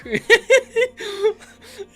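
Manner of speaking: big wheezing laugh, high and loud. Real, not acted. Big laugh after milk story.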